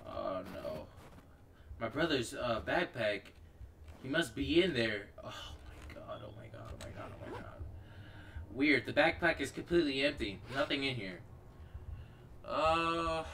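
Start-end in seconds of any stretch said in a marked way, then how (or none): 5.2-7.4 whispered panicked oh my god; frightened whisper. Real, not acted. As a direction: whisper it over and over in fear. Finds brother's empty backpack.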